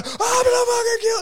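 sudden high shout; shrieked high oh; high mock yell. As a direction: yell out in road rage. Imitating raging driver.

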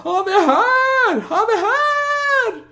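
high, slow, desperate yell from a window; boy shouting. Acted. Boy leans out a window calling to the crowd, shrill yell. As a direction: scream it out to the whole street. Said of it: "han lutar sig ut och bara".

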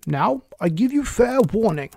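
high, imperious Queen's shout; shouted warning. A narrator's reading. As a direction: issue a threat as a storming Queen. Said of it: "shouted the Queen".

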